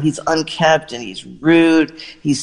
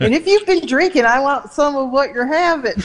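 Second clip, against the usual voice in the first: much higher, playful put-on voice; imitating Dolly; 10 semitones higher than usual. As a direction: tease her in a much higher, sparkly voice. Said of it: "and Dolly goes".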